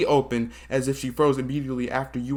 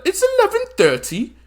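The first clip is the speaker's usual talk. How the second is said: high character voice; 6 dB louder than usual. Acted. Voicing a character.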